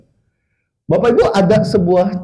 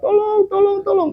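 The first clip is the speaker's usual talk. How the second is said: mock yelling; 13 semitones higher than usual. Grandmother on the roof to anyone, mock yell. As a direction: imitate granny crying out for help. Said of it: "Dia teriak".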